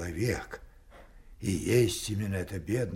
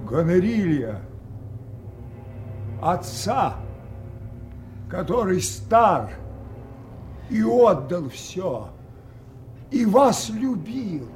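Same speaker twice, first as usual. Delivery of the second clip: anguished outcry; 8 semitones higher, 4 dB louder than usual. Acted. Lear crying out at daughters.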